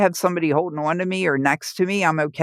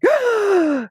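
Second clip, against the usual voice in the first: huge imitated gasp, high-pitched; sudden dramatic intake of breath, mimicking the patient; biggest gasp; 3 dB louder than usual. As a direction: suck in air sharply and loudly. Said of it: "took the biggest gasp".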